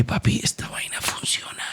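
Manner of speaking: whispered in disbelief to God; awed whisper. Retold or imitated. Telling God his faith works, in an awed whisper. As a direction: breathe it out in a hushed whisper.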